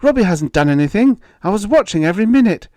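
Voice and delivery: high, loud mother's protest; crying out. A narrator's reading. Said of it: "she cried".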